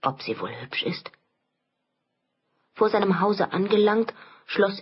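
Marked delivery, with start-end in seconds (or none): 0.0-1.1 low, soft musing; hushed, lower-pitched inner voice; private thought. A narrator's reading. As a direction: wonder to yourself under your breath. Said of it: "Bei sich dachte er noch".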